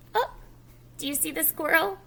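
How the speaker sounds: normal talking voice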